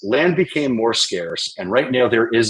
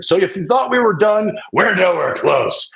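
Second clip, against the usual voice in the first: hyped up; 10 semitones higher, 3 dB louder than usual. Real, not acted. Hyping the viewer up.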